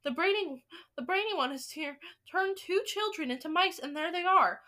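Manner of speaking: high, excited cries of alarm; crying out. A narrator's reading. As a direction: cry out like a startled crowd of witches. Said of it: "and crying out".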